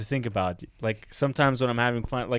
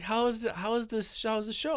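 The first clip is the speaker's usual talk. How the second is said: soft, higher-pitched voice asking a question; Claire; 9 semitones higher, 8 dB quieter than usual. Retold or imitated. Claire asking at home about the show, softly imitated. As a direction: lightly imitate a partner's gentle curiosity.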